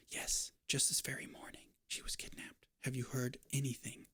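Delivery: soft unvoiced whisper. Stranger whispering the kidnapping news to the landlady. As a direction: breathe it so only she hears. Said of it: "they keep whispering like this".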